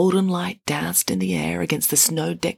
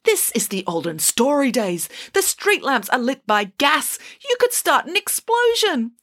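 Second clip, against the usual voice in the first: emphatic lecture climbing in pitch; scolding; 7 semitones higher than usual. A narrator's reading. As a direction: build to an alarmed high warning. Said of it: "scolded Hannah Piggins".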